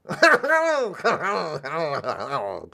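long high cackle, drawn out; Charles Nelson Riley noise. Shown as a demonstration. Host attempts the noise again on request, high drawn-out cackle. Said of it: "Could you do it again, [name]? I'll try".

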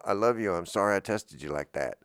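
ordinary talk

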